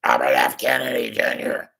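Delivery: lightly strained, a touch of a rasp; a hint of a strained old politician; quick off-the-cuff mimic, only lightly voiced; Robert F. Kennedy Junior impression. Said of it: "was a pretty good impression".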